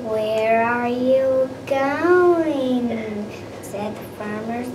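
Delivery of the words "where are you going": stretched slow and loud; slow drawn-out question. Acted. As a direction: draw each word out, calling. Farmers question gingerbread man.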